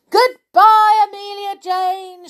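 high, loud farewell call; shouted. A narrator's reading. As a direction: call out after her as she speeds away. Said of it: "shouted the toys".

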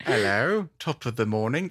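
lightly put-on Irish accent; British impression slipping into Irish; attempted British, sounding Irish. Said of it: "That's Irish".

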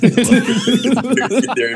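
laughing. Real, not acted. Laughs while answering.

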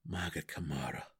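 low, soft, grim oath. A narrator's reading. Deep gnome swears beside his drow friend, grim. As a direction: drop the voice grimly. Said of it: "the deep gnome said grimly".

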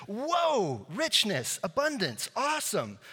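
high-pitched wowed exclamations; exclaimed awe. Acted. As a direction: gush each word high, in amazement. Acting out an awed congratulation.

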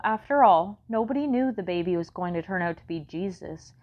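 plain reading voice

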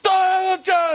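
high mock wailing "da, da"; panicked wail. Panicking he lost the ring, mock wail. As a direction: fake a panicked little cry.

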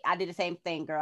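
ordinary talk

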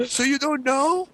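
high, slow, odd clueless voice; lost driver. Retold or imitated. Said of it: "And he's like".

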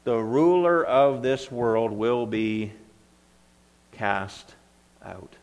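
"ruler" stressed, slowed down, with long pauses before "cast" and "out"; slow down and lean on "ruler", pausing before "cast out"; emphatic slow reading. Shown as a demonstration. Preacher drives home his point to the group, slow and stressed. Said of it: "this is the point I'm getting at".

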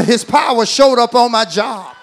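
call-and-response, building loud; preaching shout. Real, not acted. Congregation told to repeat.

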